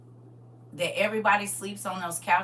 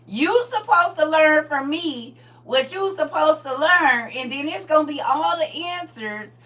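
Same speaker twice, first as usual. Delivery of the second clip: put-on a mother lecturing her kids; loud lecture; 10 semitones higher, 13 dB louder than usual. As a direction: lay down the law.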